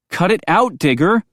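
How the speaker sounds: loud, scolding shout; yelling. A narrator's reading. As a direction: tell him off sharply. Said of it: "Brinley yelled at him".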